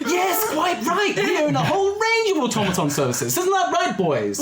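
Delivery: put-on very high, comic elderly salesman; very old man. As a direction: pipe up proudly in a high old man's voice. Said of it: "two very old men".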